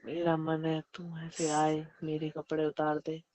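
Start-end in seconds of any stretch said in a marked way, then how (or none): none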